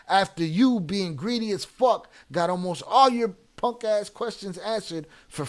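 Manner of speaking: loud, emphatic, heated complaint; angry scolding. Real, not acted. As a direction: berate them loudly, leaning on the words. Host berates a greedy chatter.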